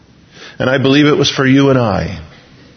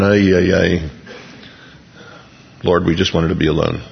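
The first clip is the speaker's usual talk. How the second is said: weary groan; 10 semitones lower, 4 dB quieter than usual. Pretend. Disciples groaning at interruption.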